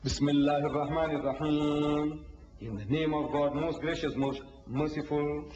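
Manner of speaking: low, measured chant on a level tone; sacred recitation. Shown as a demonstration. Speaker demonstrating the opening of each chapter, solemnly intoned. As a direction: intone it slowly, holding the final syllables.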